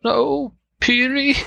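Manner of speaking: put-on high-pitched girl's voice; Sophia voice.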